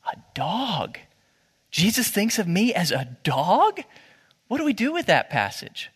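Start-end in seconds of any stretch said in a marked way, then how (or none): 0.1-3.8 slow, incredulous questioning with pauses; mock disbelief. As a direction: let it sink in, then question it in disbelief. Voicing shock at the passage.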